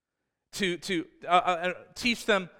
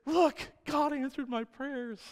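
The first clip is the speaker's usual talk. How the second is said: excited wife; 10 semitones higher, 5 dB quieter, slower than usual. Retold or imitated. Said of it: "And she said".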